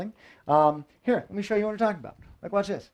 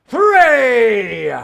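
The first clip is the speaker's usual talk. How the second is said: loud countdown finish; 5 semitones higher, 8 dB louder than usual. Real, not acted. Countdown before fire eating.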